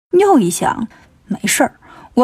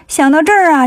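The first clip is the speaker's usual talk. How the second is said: ordinary delivery, nothing marked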